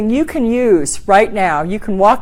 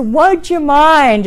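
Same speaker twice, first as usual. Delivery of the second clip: slow polite ask; 4 semitones higher, much slower than usual. Shown as a demonstration. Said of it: "is your first sugar coater".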